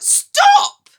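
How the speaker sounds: shrill panicked shout, very high; scream. Acted. George screaming at Grandma to stop, terrified shout. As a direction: scream it in terror.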